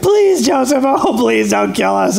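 high pleading beg; begging brothers. Retold or imitated. Brothers begging Joseph to spare them, high and pleading. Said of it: "they're like".

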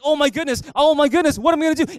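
high, loud rising panic; panicked outburst. Retold or imitated. Realizing the dinners were the final interview, loud panic. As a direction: blurt it out in a rush of alarm. Panics after missing the job offers.

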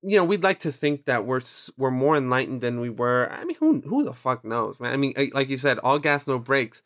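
ordinary talk, nothing marked

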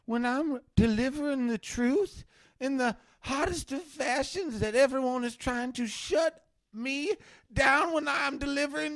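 high-pitched mock outrage; indignant rant. Ranter protests getting shut down tonight, high indignant rant. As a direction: wail it up high, aggrieved and paranoid.

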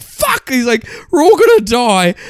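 high, loud panicked yell; mock panic. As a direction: cry out in hammed-up alarm. Acts out panicking nuclear plant worker.

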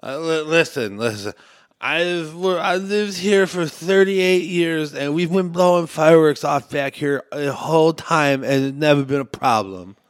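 put-on slurred drunk mom voice, high and slow; imitating a drunk woman slurring; town drunk. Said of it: "she's slurring her words".

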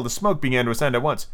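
ordinary delivery, nothing marked

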